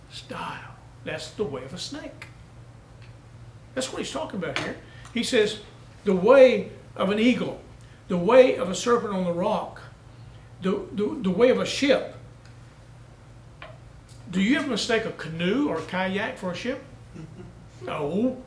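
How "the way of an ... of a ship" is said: loud ringing scripture recital, louder; booming pulpit proclamation; preaching at full force. Acted. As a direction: proclaim the verse to the back pew.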